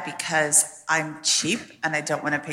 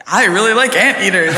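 yelling; 12 dB louder than usual. Shown as a demonstration. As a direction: shout it out loud, laughing. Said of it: "I'm gonna yell that".

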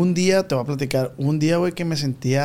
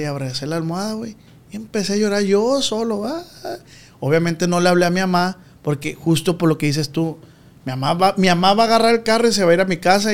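ordinary talk

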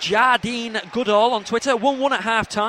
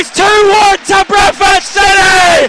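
long goal yell; 9 semitones higher, 12 dB louder, slower than usual. Real, not acted. Celebrating the goal.

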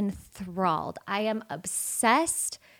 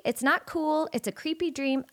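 ordinary delivery

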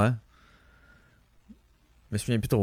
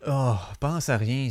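usual speaking voice

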